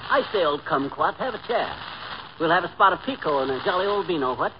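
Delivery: affected English host; put-on Bond Street English; mock British accent. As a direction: offer hospitality as a British toff. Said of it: "a fellow with a British accent".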